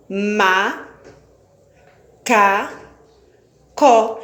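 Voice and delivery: "Ma, ca, co" cada sílaba solta, com pausas; silabando devagar. Shown as a demonstration. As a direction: separe macaco sílaba por sílaba. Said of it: "Eu abri a boca três vezes". (soletrando sílabas para crianças)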